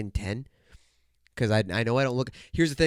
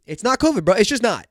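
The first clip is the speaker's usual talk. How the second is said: loud denial; 8 semitones higher, 6 dB louder than usual. Real, not acted.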